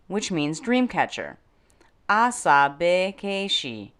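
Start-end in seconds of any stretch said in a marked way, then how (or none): 2.1-3.9 Asabekeshi said slowly, syllable by syllable: a-sa-be-ke-shi; slow word demo. Shown as a demonstration. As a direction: sound out Asabekeshi slowly and clearly for learners. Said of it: "This episode's word is asabekeshi".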